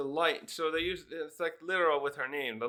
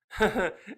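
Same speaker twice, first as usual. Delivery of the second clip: short real burst of laughter; amused laugh; 5 semitones higher, 4 dB louder than usual. Real, not acted. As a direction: laugh at the joke. Reacting to comic panel.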